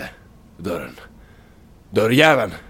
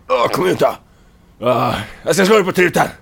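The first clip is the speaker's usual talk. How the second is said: threatening; 8 semitones higher, 4 dB louder than usual. Acted. Threatening an enemy.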